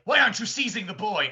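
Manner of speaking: exasperated villain demanding answers; Bowler Hat Guy. Retold or imitated. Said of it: "the bowler hat guy's like".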